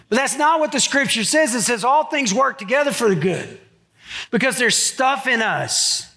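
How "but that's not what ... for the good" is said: ordinary delivery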